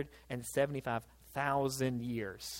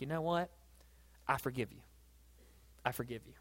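tender forgiveness; 3 semitones lower, 9 dB quieter than usual. Acted. Voicing the forgiving king.

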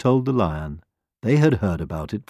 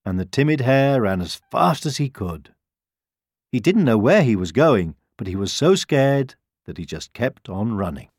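usual speaking voice

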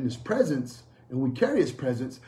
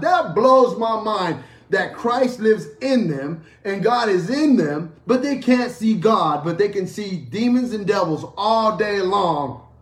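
higher, louder, slow and incredulous sermon delivery; forceful preaching; 5 semitones higher, 6 dB louder than usual. Acted. Preacher astonished at what believers claim to see, forceful preaching. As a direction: hammer the contrast home, stretching it out.

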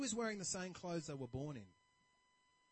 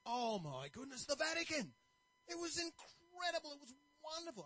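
delighted traveler; 8 semitones higher than usual. Retold or imitated. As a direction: swoon over the memories. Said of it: "he's really happy".

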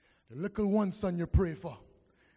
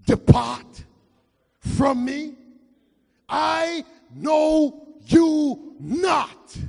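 slow, louder words of God; stern judgment voice; 8 semitones higher, 12 dB louder, much slower than usual. Acted. Preacher voicing God turning him away, slow and loud. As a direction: pronounce it like final judgment.

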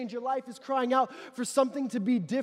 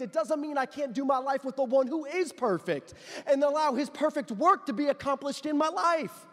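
normal talking voice

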